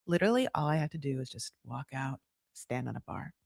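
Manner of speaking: soft, low murmured thought; internal monologue. Retold or imitated. Voicing Sophie's unspoken thought at the bar, hushed. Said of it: "She just says it internally".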